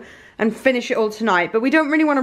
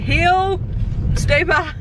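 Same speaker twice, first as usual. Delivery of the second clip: playful dog command; 7 semitones higher, much slower than usual. Retold or imitated. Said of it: "I was like".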